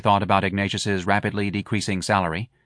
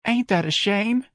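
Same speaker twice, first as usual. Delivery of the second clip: high, slow, emotional lament; high-pitched, overcome with pity; 12 semitones higher, slower than usual. A narrator's reading. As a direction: draw out the sympathy in a high voice. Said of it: "Missus Riley said emotionally".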